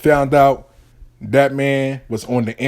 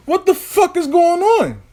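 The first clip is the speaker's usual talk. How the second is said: much higher, slow and stretched out; high drawn-out disbelief. Acted. Boy finally demanding what is happening, slow, high and stunned. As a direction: stretch every word in shocked disbelief.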